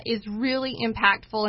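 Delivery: ordinary talk, nothing marked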